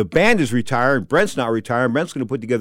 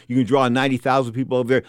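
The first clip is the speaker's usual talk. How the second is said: normal talking voice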